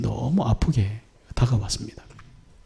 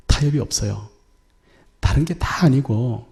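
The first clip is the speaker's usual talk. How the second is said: normal talking voice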